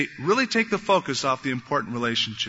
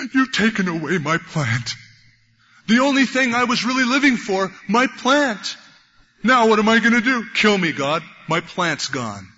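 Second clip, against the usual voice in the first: hammed-up outrage, high and loud, fading at the end; angry lament; 5 semitones higher, 4 dB louder than usual. Jonah angry at God over his dead plant, mock-tantrum lament. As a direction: wail melodramatically at the loss, then deflate. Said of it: "he gets angry at God".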